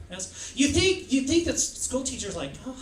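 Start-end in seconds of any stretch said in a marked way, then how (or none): 2.7-2.7 tiny, soft, higher hello; mock-dainty, hushed teacher voice; sweet teacher greeting. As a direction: coo a gentle little greeting. Acts sweet school teacher greeting.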